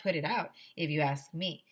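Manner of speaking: ordinary delivery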